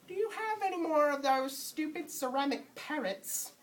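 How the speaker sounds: high-pitched put-on customer voice; customer asking. Customer asking a store clerk about parrots, high put-on voice. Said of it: "if a customer were to ask".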